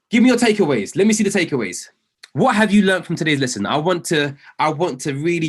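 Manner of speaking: high, loud rallying cry; excited hype. Real, not acted. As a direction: pump up the chat for answers. Hyping the audience for replies.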